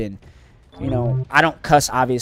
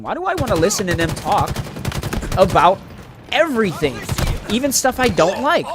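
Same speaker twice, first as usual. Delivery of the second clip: high, swooping voice of giddy bewilderment; mock amazed wondering; 7 semitones higher than usual. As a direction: act out marveling at a new best friend, pitched way up. Voicing wonder at a new instant friendship.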